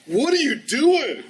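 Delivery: high, loud, drawn-out question; imitating a baffled wife, stretching it out; mock exasperated disbelief. Wife demanding answers from flirting husband, mock exasperated.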